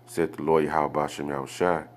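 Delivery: normal talking voice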